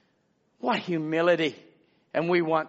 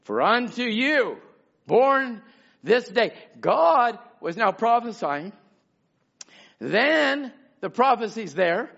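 raised preacher's voice, weighty and deliberate; emphatic preaching; 7 semitones higher, 5 dB louder than usual. Real, not acted. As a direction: proclaim it to the congregation. Preaches, proclaiming scripture.

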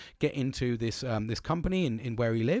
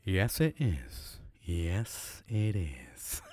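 low, slow, self-satisfied put-on voice; hammed-up smugness, dropped low and quiet; smug, savoured reply; 5 semitones lower, 5 dB quieter, much slower than usual. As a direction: savour each word like a pleased regular. Smug reply at being known.

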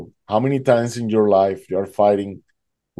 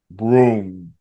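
imitated thunder; 2 semitones lower than usual.

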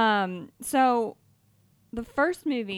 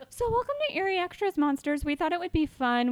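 excited; 9 semitones higher, 4 dB louder, faster than usual. Real, not acted. Fast, high show opener.

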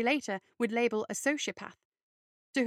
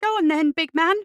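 high, loud goading; mock the bully in a raised voice; furious dare from the underdog; reckless taunt; 6 semitones higher, 9 dB louder than usual. A narrator's reading. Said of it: "Radley continued recklessly".

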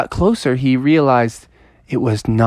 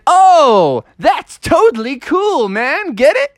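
high, goofy punning frog; high joking frog; 13 semitones higher, slower than usual. Acted. Froggy joking to Toadstool, high and goofy. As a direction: land the joke up high and pleased with yourself.

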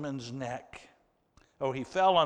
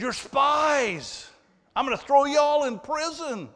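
higher, louder harsh accusation; 9 semitones higher, 6 dB louder than usual. Retold or imitated. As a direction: bark the threat like an angry ruler. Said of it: "he spoke to them harshly".

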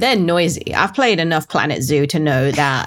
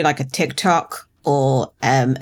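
ordinary talk, nothing marked

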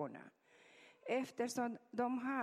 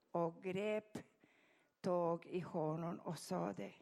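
ordinary talk

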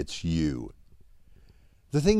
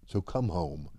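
soft invitation; 5 semitones lower, 8 dB quieter than usual. Acted. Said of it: "mouths two simple words".

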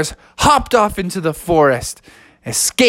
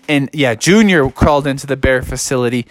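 ordinary delivery

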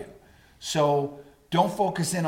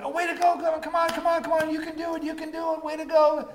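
high excited cheering, much higher; cheering encouragement; 12 semitones higher, faster than usual. Retold or imitated. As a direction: cheer them on like a fan. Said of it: "they know that voice".

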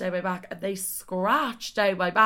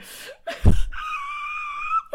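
high, piercing burst of laughter; shrieking laugh; 4 dB louder than usual. Real, not acted. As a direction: cackle helplessly at own joke. Cracking herself up.

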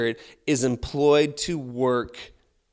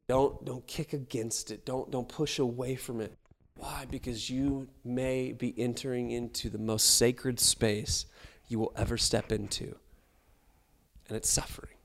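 gentle plea; 6 dB quieter than usual. Real, not acted. Quiet tender pastoral plea.

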